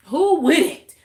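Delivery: high, loud indignant "who wouldn't"; emphatic outcry. Real, not acted. To viewers, loud indignant outcry. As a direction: throw the question out, outraged.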